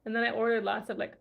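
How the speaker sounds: normal talking voice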